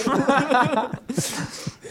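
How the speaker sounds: loud high real laughter. Real, not acted. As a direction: let out a big laugh. Laughter after the crunching.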